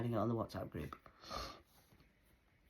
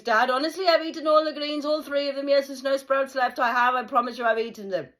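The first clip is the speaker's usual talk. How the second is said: put-on loud, much higher insisting kid; pleading child; 12 semitones higher, 12 dB louder than usual. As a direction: swear to dad you ate them all. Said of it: "My brother".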